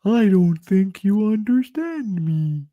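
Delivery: mocking Charlie Hunnam's accent. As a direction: mimic his strange put-on voice. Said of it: "What's with the accent".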